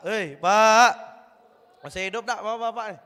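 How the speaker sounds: ordinary talk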